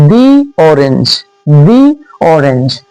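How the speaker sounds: slow, careful "the" said as "dee" before orange; teacher's slow model of "the orange". Shown as a demonstration. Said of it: "इसे हम पढ़ेंगे the orange".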